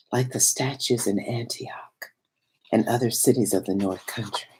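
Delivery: barely voiced, secret telling; hushed whisper. A narrator's reading. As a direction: breathe the words as if he might hear. Hushed memory of the watching stranger.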